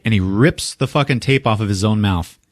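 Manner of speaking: ordinary delivery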